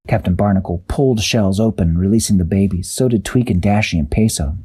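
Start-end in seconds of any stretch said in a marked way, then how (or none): none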